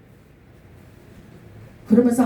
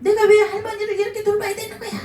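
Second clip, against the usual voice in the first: high, fast, whiny complaint; irritated outburst; 10 semitones higher, faster than usual. Retold or imitated. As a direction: mimic an annoyed young woman griping. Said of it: "막 화가 나가지고 짜증 나가지고". (짜증 섞인 높은 목소리로 빠르게 투덜대기)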